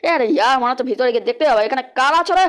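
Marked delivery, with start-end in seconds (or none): none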